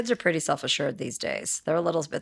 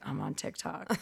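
sister mumbling; 5 semitones lower, 11 dB quieter than usual. Retold or imitated. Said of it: "She's like".

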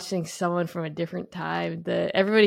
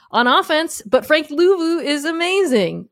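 excited; 9 semitones higher, 7 dB louder than usual. Real, not acted. Hyping up a favorite player.

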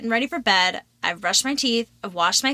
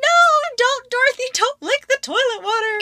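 retold panicked yell. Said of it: "And I'm like".

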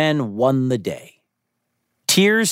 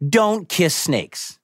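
slow, deliberate warning to listeners; 6 semitones higher, much slower than usual. Real, not acted. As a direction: spell it out slowly, firmly. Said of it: "say this real quick to our audience".

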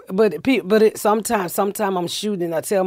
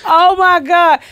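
excited oh my God; 11 semitones higher, 9 dB louder, slower than usual. Real, not acted. Reliving the shock.

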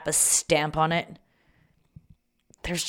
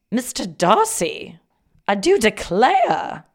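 slow, raised period-drama voice sliding Southern; drifting into Southern; 5 semitones higher, 7 dB louder, slower than usual. Pretend. Swooning over a rom-com letter, accent turning Southern. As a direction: put on a louder, drawn-out Southern-belle lilt. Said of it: "now I'm switching to, like, Southern".

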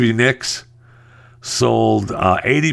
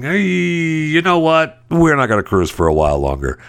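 mock cruise spokesman; 8 semitones higher than usual.

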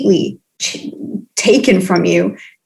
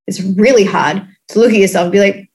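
ordinary talk, nothing marked

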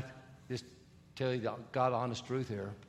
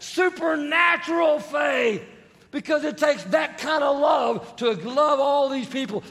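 much higher, shouted preaching; full-force preaching; 12 semitones higher, 12 dB louder than usual. Acted. Preacher shouting to church about mega faith. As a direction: build to a roaring climax.

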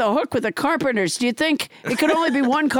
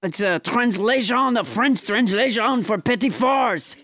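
hammed-up French accent, explaining; French Trump; 3 semitones lower than usual. French Trump explains a translation to the host, French accent. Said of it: "your extremely realistic accent".

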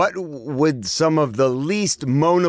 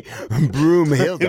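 mock moan; 2 semitones higher, slower than usual. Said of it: "as you were moaning".

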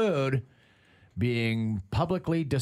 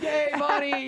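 high cheer for the little guy; 12 semitones higher, 3 dB louder than usual. Retold or imitated. Family cheers the son at daycare pickup, high. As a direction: whoop it up high for the kid.

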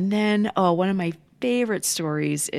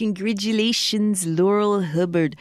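rough attempt at a New Zealand accent; 4 semitones higher, 3 dB louder than usual. Co-host congratulating the athlete, rough New Zealand accent. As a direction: put on a hammy, louder New Zealand accent. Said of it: "That's my, uh, New Zealand accent".

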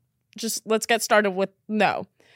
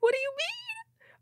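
much higher, softer, whiny; devastated; 7 dB quieter than usual. Real, not acted. Upset over a ditched date, high whiny dismay. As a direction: squeak it out in dismay. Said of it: "so upset right now".

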